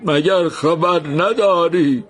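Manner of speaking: slow sorrowful question. Acted. Man asks sorrowfully.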